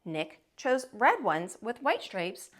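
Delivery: plain reading voice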